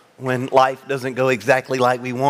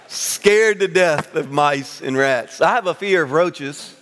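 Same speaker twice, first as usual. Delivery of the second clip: normal talking voice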